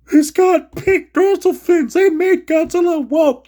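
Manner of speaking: high, loud whiny mock of the critics; mocking complainers. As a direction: parody the fans who whine about the fins. Mocking fans who complain.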